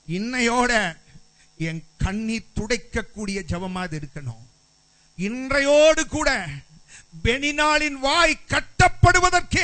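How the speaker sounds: high, loud, impassioned pleading preacher; fervent loud prayer. Acted. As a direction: cry out the prayer with full force. Voices Hannah's prayer loudly.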